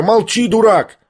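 high-pitched, exasperated shout; boy's angry cry. A narrator's reading. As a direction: shout your brother down, high and cross. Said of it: "закричал Коля". (сердитый мальчишеский выкрик)

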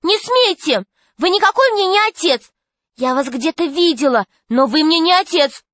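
put-on high-pitched girl's defiance; protesting cry. A narrator's reading. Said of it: "закричала вдруг Алиса".

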